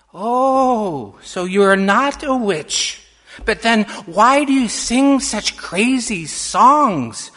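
loud, high-rising, wide-eyed questioning; curious little girl. A narrator's reading. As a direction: press her like an inquisitive child.

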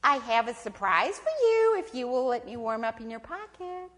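loud, swooping sales pitch; coaxing snake. Acted. Snake tempting possum with a surprise, loud coaxing. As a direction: wheedle with big swoops of pitch.

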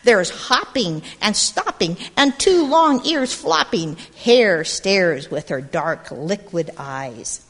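put-on lively read-aloud to a group of kids; storybook narration. A narrator's reading. Storyteller reading to gathered children, lively narration. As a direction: tell it like a picture book at storytime. Reads picture book aloud.

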